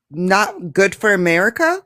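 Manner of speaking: ordinary talk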